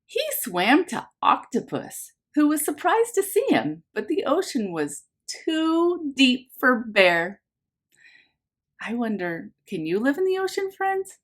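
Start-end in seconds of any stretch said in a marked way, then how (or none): 0.1-7.4 lively picture-book narration for a class; reading to children. A narrator's reading. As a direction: read it like story time for little ones. Teacher reads picture book aloud.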